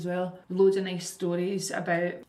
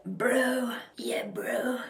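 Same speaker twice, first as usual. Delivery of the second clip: lightly voiced laid-back dude; a hint of a chill American bro; 4 semitones higher, much slower than usual. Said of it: "read by this American guy".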